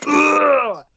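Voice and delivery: loud, high, drawn-out groan of frustration; exasperated groan. Real, not acted. Fan fed up with mispronounced names, loud exasperated groan.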